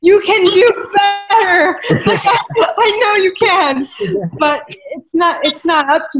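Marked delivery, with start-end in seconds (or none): 0.0-3.9 urging. Retold or imitated. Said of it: "shake people and be like".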